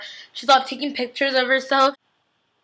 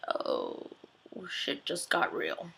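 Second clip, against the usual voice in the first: much softer, slow hushed "oh shit"; hushed shock; 6 semitones lower, 17 dB quieter, much slower than usual. Retold or imitated. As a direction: let it fall out in stunned awe, quietly. Stunned reaction to a slap.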